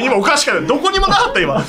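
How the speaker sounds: high, loud, laughing disbelief; host cracking up in disbelief at the guest's story; incredulous outburst. Real, not acted. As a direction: squeal it out in amazement.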